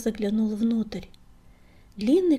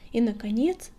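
ordinary delivery, nothing marked

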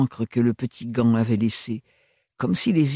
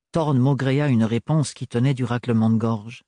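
plain reading voice

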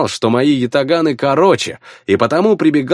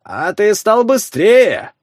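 sarcastic; 7 semitones higher, slower than usual. A narrator's reading. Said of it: "язвительного комментария".

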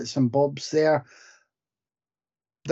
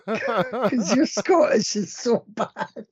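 laughing tease; 9 semitones higher, slower than usual. Real, not acted. Teasing friend about his accent.

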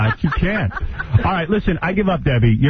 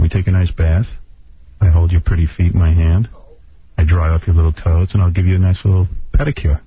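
seductive voice; 8 semitones lower than usual. Acted. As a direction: lay on the fake romance. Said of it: "give me a sexy voice".

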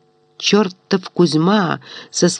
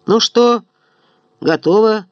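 calling out; 4 semitones higher than usual. Acted. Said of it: "крикнул он мужичкам".

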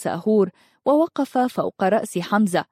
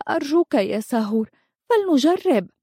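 earnest, high-voiced entreaty; pleading; 6 semitones higher than usual. A narrator's reading. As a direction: beg him, rising to a high-pitched appeal. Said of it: "قالت منارش برجاء". (برجاء وتوسّل)

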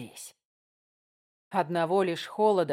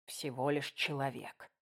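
much softer, lower hurt repeat; quiet echo; 5 semitones lower, 10 dB quieter than usual. A narrator's reading. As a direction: murmur the words, wounded. Narrator echoing words, hurt.

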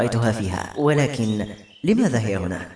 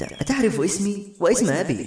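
higher-pitched, astonished question; puzzled surprise; 6 semitones higher than usual. A narrator's reading. Woman asking how a stranger knows her name, surprised. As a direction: ask it in mild disbelief. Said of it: "طلعتني باستغراب وقالت".

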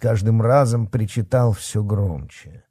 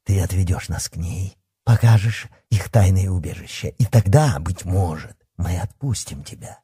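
ordinary delivery, nothing marked

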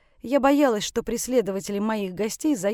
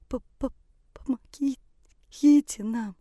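high, stammering through chattering teeth; shivering, teeth knocking on each word; 4 semitones higher, much slower than usual. Acted. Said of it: "Стуча зубами, еле проговорила гостья".

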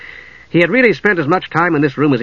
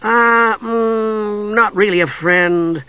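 high, long-drawn uh and mm; hesitant admission; 8 semitones higher, much slower than usual. A narrator's reading. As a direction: backtrack slowly and awkwardly in a high voice. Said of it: "the Kender admitted".

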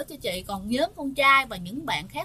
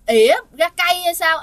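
loud, raised-pitch retold scolding; grandmother's scolding; 5 semitones higher, 9 dB louder than usual. Grandmother yelling at whoever is hogging the hospital toilet, scolding. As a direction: snap it out loudly, imitating an irate grandmother. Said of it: "bà nội chị mới chửi".

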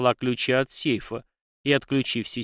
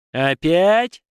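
loud, high irritated question; fed up, pitch shooting upward; disgusted; 6 semitones higher, 8 dB louder than usual. A narrator's reading. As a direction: bark it with a wince of annoyance. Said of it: "скривился дракон".

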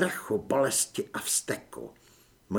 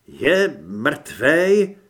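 hesitant cultist; 7 dB louder than usual. Acted. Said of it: "zeptal se váhavě kultista".

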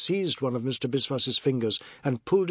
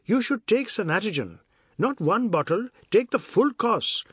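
higher, emphatic lilting character voice; advising Ajoda; 8 semitones higher, 3 dB louder than usual. Acted. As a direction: press the remedy on him, pitched up. Ajoda gives health advice.

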